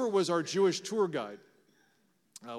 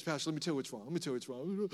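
softer mock grumble of bad news; complaining churchgoers; 7 dB quieter, much faster than usual. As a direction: lightly imitate someone unloading troubles on the pastor. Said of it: "people come into my office".

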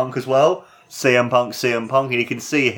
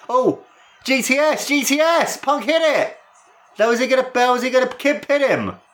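excited climax; 13 semitones higher than usual. Real, not acted. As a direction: call the win at peak hype. Climactic finish of the match.

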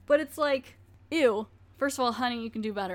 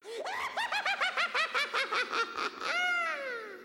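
high laugh. Real, not acted. Laughing at her own outburst.

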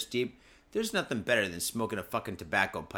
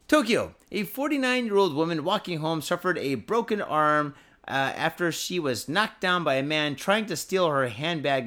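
louder, slightly raised news-reading voice; 4 semitones higher, 4 dB louder than usual. Acted. Reading the Tokyo story to his viewers, news-read voice. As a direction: read the headline story like an announcer.

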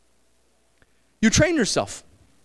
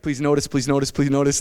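much lower, hushed, hopeful "please notice"; pleading inner voice; 10 semitones lower than usual. Retold or imitated. Preacher voicing a girl hoping someone notices her lashes, hushed pleading.

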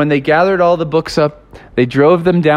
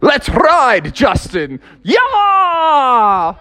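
higher, rising to a whoop; whooping Abe; 9 semitones higher, much slower than usual. Retold or imitated. Abe hops on Justin's back and whoops, high. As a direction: holler like you're taking off.

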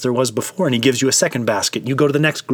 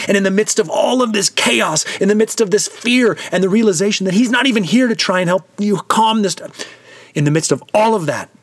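higher and higher, pressing urgently; building storm intensity; 6 semitones higher than usual. A narrator's reading. Preacher painting the storm on Galilee, urgently building. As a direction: ride the rising storm, more urgent each line.

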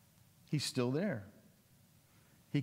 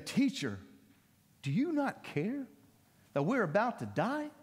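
panicked plea; 4 semitones higher than usual. Retold or imitated. Disciples panicking at sleeping Jesus.

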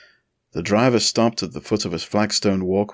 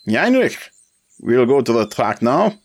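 put-on much higher, louder call in German accent; raised voice; 5 semitones higher, 6 dB louder than usual. A narrator's reading. Ackerman calling to his chauffeur, raised voice. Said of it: "raised his voice to the chauffeur".

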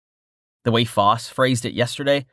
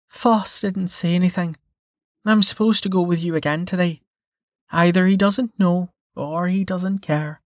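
put-on higher, dry teasing young woman; Melka voice; 8 semitones higher than usual. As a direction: tease him in a higher voice. Melka's voice in the story.

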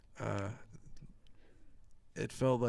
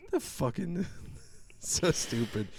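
ordinary talk

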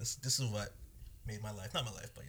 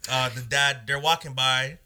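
ordinary talk, nothing marked

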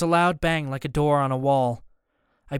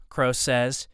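ordinary delivery, nothing marked

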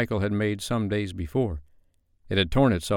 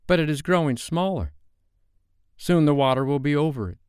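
put-on slightly boyish, higher-pitched warning; lightly voiced Peter; 6 semitones higher than usual. A narrator's reading. Peter warning Wendy of the rising water, lightly voiced higher. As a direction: lightly play a boy giving a warning.

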